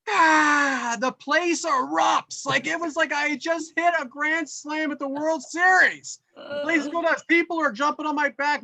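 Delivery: high, thrilled rush of a victory story; excited. Retold or imitated. Dad recalls crowd cheering his dunk, high and thrilled. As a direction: tell it like you just won the World Series. Crowd erupts after the hit.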